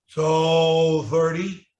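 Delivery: So thirty stretched slowly; drawn out. Shown as a demonstration. Teacher models crutch four for the student, drawn out. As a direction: drag out the So before thirty. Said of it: "how would you do it with crutch number four".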